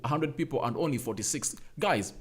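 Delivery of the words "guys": usual speaking voice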